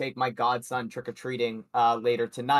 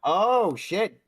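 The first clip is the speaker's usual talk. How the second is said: sudden higher, louder oh shit; surprised; 7 semitones higher, 5 dB louder than usual. Real, not acted. Host hearing Devin Booker is ruled out, surprised. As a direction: caught off guard by the news. Reacting to injury news.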